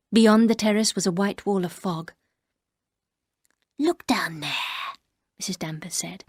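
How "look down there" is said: slow, hushed, sinister urging; menacing whisper. Acted. Housekeeper luring the narrator to the window, hushed. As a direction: tempt her quietly. Danvers urges her to jump.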